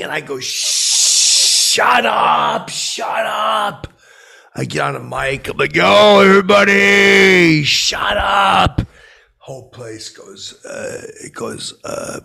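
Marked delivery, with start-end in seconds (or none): 0.4-3.9 high furious shout; yelling shut up. Retold or imitated. Yelling at party crowd. 5.7-8.8 high, slow booming shout into the mic. Retold or imitated. On the mic hushing party.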